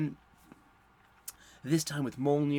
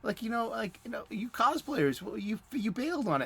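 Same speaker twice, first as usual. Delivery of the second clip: high teasing voice of a buddy calling him out; mocking friend voice; 8 semitones higher than usual. Friend teasing a cosplayer at the con, high mock voice. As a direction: pitch way up and rib him like an old pal. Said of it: "and he's like".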